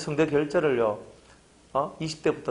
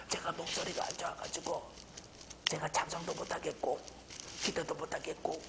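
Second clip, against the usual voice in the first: much softer, nearly voiceless apology; hoarse whisper; 16 dB quieter than usual. Retold or imitated. Pastor imitating himself excusing his lost voice, whispering. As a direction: whisper it like the voice has given out. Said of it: "이렇게 하는 거예요".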